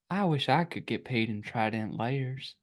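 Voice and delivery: slow, soft commercial guy voice; lightly voiced ad electrician, slow; electrician impression. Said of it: "that was a good impression".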